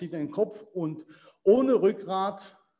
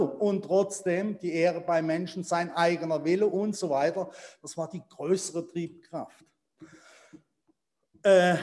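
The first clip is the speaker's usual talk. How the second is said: ordinary delivery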